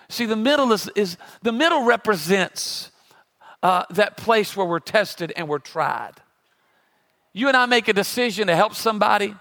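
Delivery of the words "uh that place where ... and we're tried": ordinary talk, nothing marked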